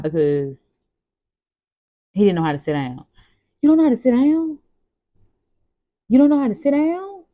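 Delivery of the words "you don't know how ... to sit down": put-on high, loud incredulous question to a toddler; mock disbelief. Mother to her crying three-year-old, in high mock disbelief. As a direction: act amazed at the kid's excuse.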